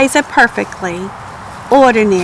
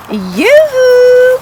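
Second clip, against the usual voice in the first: a very high, loud sing-song yoo-hoo; calling out; 13 semitones higher, 6 dB louder than usual. Acted. Egg lady calling from the door, high loud sing-song call. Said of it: "called the egg lady".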